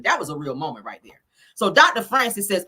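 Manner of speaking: ordinary talk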